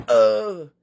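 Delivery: hammed-up hurt character; mock pained yelp. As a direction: act out a silly cry of pain. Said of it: "he's like".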